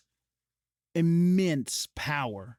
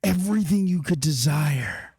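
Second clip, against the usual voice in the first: louder, far-off seductive coaxing; the book whispering temptation, pressing louder; tempting voice; 4 dB louder than usual. Acted. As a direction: lure him in like a devil's bargain. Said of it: "the voice hears you say, it says".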